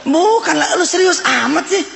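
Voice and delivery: high-pitched mock teasing retort; amused boss. Boss teasing the tearful security guard, amused. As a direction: play the boss tickled by the joke. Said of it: "Wih, ketawa si bos".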